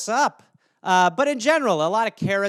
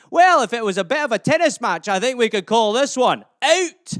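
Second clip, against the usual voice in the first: hammed-up Scottish lilt; Scottish accent; 3 dB louder than usual. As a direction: play a chatty Scot, pitched up. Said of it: "Are you not Scottish?".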